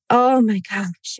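normal talking voice

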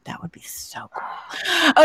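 slow, hushed whisper. Real, not acted. Whispered aside about accent poem.

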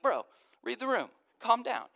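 high, casual mock exasperation; lightly voiced fed-up buddy; exasperated bro. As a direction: roll your eyes at an overeager friend. Jesus voiced as exasperated friend.

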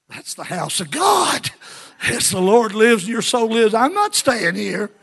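high, loud, drawn-out defiance; defiant shout. Acted. Servant refusing to leave his master, defiant shout. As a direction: shout it as a vow of loyalty.

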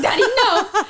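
put-on high, loud little-girl plea; pleading child. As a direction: plead with dad in mock panic. Child pleading before a spanking.

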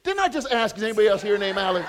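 loud, high protest tipping into laughter; incredulous teasing, speeding up into a laugh; laughing mock exasperation. Real, not acted. Teases man who didn't answer.

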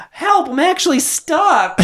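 very high, slow parody stepmom; mock cry for help. Stepmom really stuck on the couch, mock distress. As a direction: squeal it like a hammed-up damsel.

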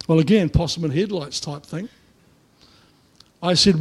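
ordinary talk, nothing marked

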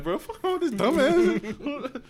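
high, slow words broken by laughter; laughing. Retold or imitated. Student mocking his teacher in his head, laughing. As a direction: laugh out the inner thought. Inner thought while laughing.

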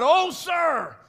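high, retold cry of despair. Son of the prophets crying out to Elisha, anguished. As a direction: wail it like a preacher acting out loss. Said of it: "He cried".

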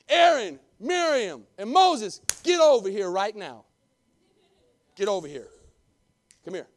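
loud commanding call, rising then settling; angry God. Acted. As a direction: call them out like an angry father. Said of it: "He's mad".

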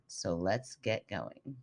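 slow, measured "So let's get going."; careful reading voice. Acted. Trying to impress the college professor, reading slowly. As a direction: read it like a careful announcer. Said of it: "felt the pressure to read this really well".

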